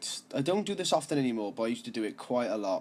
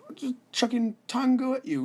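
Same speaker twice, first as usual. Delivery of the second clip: cheeky reply; 13 semitones higher, 4 dB louder than usual. Retold or imitated. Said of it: "And I was like".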